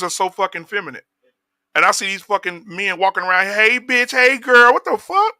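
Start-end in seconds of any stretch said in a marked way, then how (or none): 3.5-5.3 high, sassy gossiping voice; mocking effeminate man. Mocking feminine men talking.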